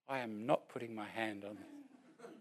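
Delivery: low, muttered aside, half laughing; muttered refusal. Retold or imitated. Young man refusing to touch greasy hair, muttered softly.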